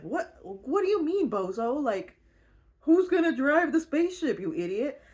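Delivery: high scoffing retort, lightly voiced; mocking girls. Captive girls mocking Georgie's plan, a lightly voiced scoff. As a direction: scoff at her like she's dumb. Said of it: "the girls are like".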